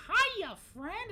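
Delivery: high, loud mock-friendly holler; shark's squealed greeting, hammed up. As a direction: pop up with a loud, high-pitched hello. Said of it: "when Grace comes back and goes".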